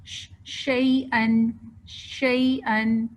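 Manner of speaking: شیئن with the ش hissed long and spread, very slowly; drawn-out sh. Shown as a demonstration. Teacher modelling tafashshi on شیئن with fatha, slow demo. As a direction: stretch شیئن, let the air spread on the ش. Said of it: "اسی طرح اگر ہم زبر کے ساتھ دیکھیں".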